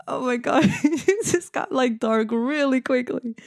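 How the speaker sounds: helpless laughter between the words; laughing. Real, not acted. Speaker reacting to own dark joke, laughing. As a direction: talk through real giggles, pitch jumping up.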